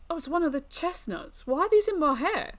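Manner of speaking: high-pitched, puzzled exclamation; baffled surprise. Acted. Sleepy traveller finding chestnuts in their hair, baffled. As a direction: pitch jumps up in bewilderment.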